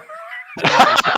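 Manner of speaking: wheezy unvoiced giggle; breathless high laugh; high breathy laugh. Real, not acted. Friend froze on call.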